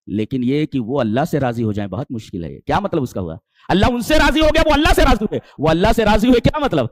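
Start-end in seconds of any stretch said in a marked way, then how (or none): 3.7-6.8 high, loud, ringing sermon cry; preaching at full force. Acted. Preacher calling out to the congregation, full force. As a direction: lift the hall with it, loud and high. Preacher stresses companions' contentment.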